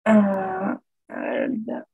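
ordinary delivery

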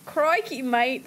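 hammed-up Australian catchphrase; Aussie accent. Streamer testing the requested accent, mock Aussie. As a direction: put on a broad, clichéd Aussie accent. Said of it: "You want me to do an Aussie accent".

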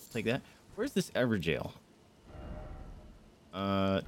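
usual speaking voice

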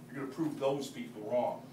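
ordinary delivery

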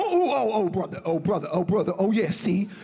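milk each oh brother; John's catchphrase. Retold or imitated. Impersonating John Witherspoon's catchphrases, loud hammed-up delivery.

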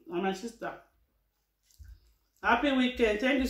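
eating between sentences; chewing while talking. Real, not acted. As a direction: mouth partly full of food. Seen 2: Holds bowl, puts food to mouth 2.9–5.0, chewing between words.

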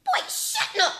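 high, sharp scolding of her son; angry yell. Acted. Mom yelling at son.

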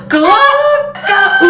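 high, laughing glocka-oo crow; rooster crow attempt. Rudy trying his crow a second time, high and laughing. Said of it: "Let me try that again".

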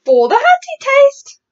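high, loud and drawn out; booming exclamation from the BFG; giant's eager cry. Acted. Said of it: "cried the B F G".

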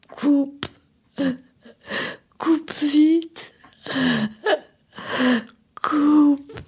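high, tearful pleas to hang up; crying, begging her lover to hang up. Acted. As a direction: sob out the orders, voice breaking.

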